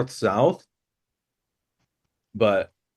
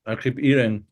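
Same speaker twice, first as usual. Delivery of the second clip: talking through a mouthful; words round a bite of food; mouth full. Acted. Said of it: "my mouth is full".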